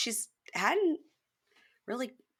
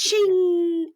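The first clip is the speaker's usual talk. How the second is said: high sung chime sound; playful ding sound effect; mock chime; 10 semitones higher, 7 dB louder than usual. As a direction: ring out a doorbell ding. Doorbell sound effect announcing arrival.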